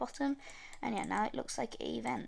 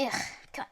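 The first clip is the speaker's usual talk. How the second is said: exasperated whispered groan; sigh, then whisper it in frustration; frustrated sigh; much faster than usual. Real, not acted. Struggling with the software.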